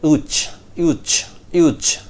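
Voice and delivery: "uch" as a short "uch" ending in ch; new linking sound. Shown as a demonstration. Teacher isolates the sound natives invent, short. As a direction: end "uch" on a crisp ch. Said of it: "invent a new sound".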